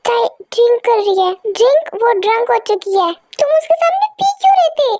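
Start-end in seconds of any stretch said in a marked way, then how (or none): 3.4-4.9 high, fast angry yell; angry shouting. Acted. [name] yelling at [name] for drinking, high fast angry shout. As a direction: blast him with the question, furious. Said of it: "क्यों चिल्ला रहे हो [name] पे".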